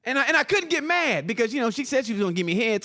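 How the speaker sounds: usual speaking voice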